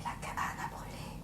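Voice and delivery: low solemn whisper; grave whisper. A narrator's reading. Solemn end of fire.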